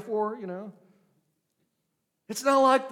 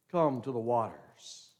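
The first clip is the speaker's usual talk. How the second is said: softer, lower, hushed invitation; hushed repeat; 10 semitones lower, 7 dB quieter than usual. Acted. Preacher softly repeating his call to the congregation, hushed. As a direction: let the call fall to a near hush.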